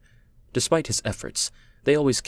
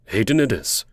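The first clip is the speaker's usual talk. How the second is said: louder, decided announcement; firm, louder pronouncement; declaring; 5 dB louder than usual. Acted. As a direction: declare it, giving in to the child. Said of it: "Kai declared".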